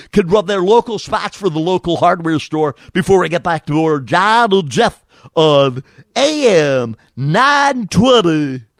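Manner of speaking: booming radio bumper read, slowing at the end; announcer voice. Pretend. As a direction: sell it like a station ID.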